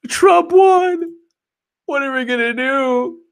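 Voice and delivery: high, loud hammed-up panic; mock panicked agent. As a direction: play the horrified agent over the top. Mocking agents' reaction to election.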